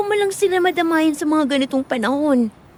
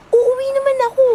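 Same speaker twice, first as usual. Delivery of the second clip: sudden loud higher snap; upset outburst; 8 semitones higher, 4 dB louder than usual. Acted. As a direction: blurt it out in frustration. Upset girl snaps at nanny.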